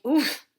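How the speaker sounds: knocked breathless by a soaked angel hug; winded strangled oof. Acted. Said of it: "let out a strangled".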